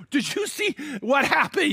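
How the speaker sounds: high, amazed question; a witness gasping to a neighbour; astonished. Retold or imitated. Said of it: "more than one person's like".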